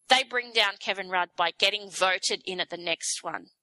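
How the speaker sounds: normal talking voice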